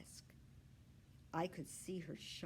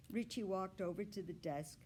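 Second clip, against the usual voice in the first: usual speaking voice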